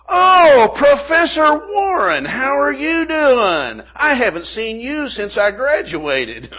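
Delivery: hammed-up delighted student; gushing agent. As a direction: gush over a beloved old teacher. IRS agent greets his old professor.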